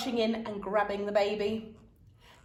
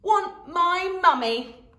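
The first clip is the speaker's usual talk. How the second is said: put-on loud slow toddler refusal; bellowing toddler; 8 semitones higher, 6 dB louder, much slower than usual. A narrator's reading. Toddler refusing dad, bellowed. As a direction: bellow like a stubborn baby. Baby voiced.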